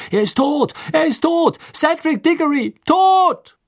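high, horrified screeching crowd; loud, panicked shrieks; shrieking. A narrator's reading. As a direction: shriek the news out into the night. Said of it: "kreischten die Worte in die Nacht hinaus".

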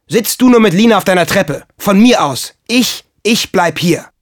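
loud, high, sulky outburst; bitter shout. A narrator's reading. Boy tells his friend to stay with Lina, bitter shouting. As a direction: shout it with hurt defiance. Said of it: "schrie Michel bitter".

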